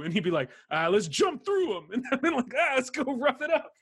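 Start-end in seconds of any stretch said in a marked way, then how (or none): 0.7-1.9 slow, higher Brooklyn tough-guy voice; Brooklyn accent. Acted. Gang leader rallying his crew for a fight, mock Brooklyn accent. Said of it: "like a Brooklyn accent". 2.6-3.7 high-pitched mock Brooklyn gangster; Brooklyn accent. Gang member egging the others on to fight, mock Brooklyn accent. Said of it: "like a Brooklyn accent".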